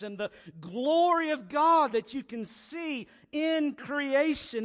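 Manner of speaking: booming sermon voice; preaching at full force. Acted. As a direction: proclaim it to the whole church.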